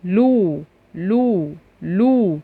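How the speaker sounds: "لو" said slowly and clearly; sound demo. Shown as a demonstration. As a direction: sound out "لو" for the class. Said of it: "بالضمة".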